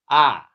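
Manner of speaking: "Ah" as a big, round, open vowel; "Ah" like the a in father, full and loud. Shown as a demonstration. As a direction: open wide and let "Ah" ring out loud. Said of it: "should be full, deep, and come from the back".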